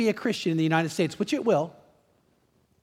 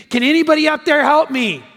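high, loud cry for help; acted scream for help; 6 semitones higher, 6 dB louder than usual. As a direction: call up desperately from below. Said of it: "so he starts screaming".

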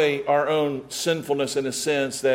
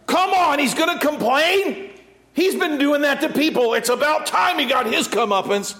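exasperated grumble; 9 semitones higher than usual. Pretend. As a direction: vent impatience at a whiner. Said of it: "that's what we would do".

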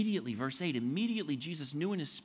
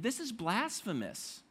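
ordinary talk

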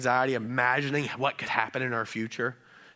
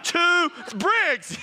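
high, loud exasperated mom counting to three; mother threatening her son Briggs, high and loud; mock mom threat; 10 semitones higher, 4 dB louder than usual. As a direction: play the fed-up parent warning her toddler. Parent threatening child, counting.

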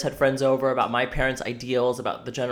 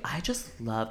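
sultry voice; 4 semitones lower, 12 dB quieter, slower than usual. Retold or imitated. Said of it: "weird sultry voice".

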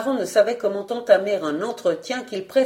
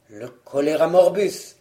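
low, grave pronouncement; name the plague gravely and low; low, doom-laden husband; dire excuse; 4 semitones lower than usual. Acted. Husband invents another grim excuse.

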